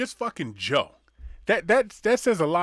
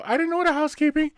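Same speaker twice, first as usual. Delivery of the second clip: high squeaky voice; 11 semitones higher, 3 dB louder, faster than usual. Acted. Joke, resident voice.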